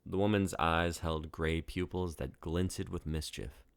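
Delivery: ordinary delivery, nothing marked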